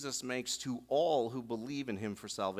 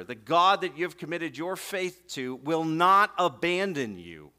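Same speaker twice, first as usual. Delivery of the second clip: ordinary talk, nothing marked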